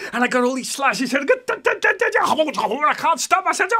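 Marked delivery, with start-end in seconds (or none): 1.4-3.7 high sound effects. Acted.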